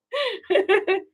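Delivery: short, high burst of laughter. Real, not acted. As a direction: laugh out at the compliment. Laughs at the host's remark.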